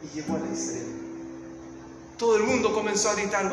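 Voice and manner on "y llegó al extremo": low, very soft, almost breathless telling; suspense hush. A narrator's reading. Preacher builds suspense as the walker reaches the end, low hush. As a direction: draw them in, nearly silent.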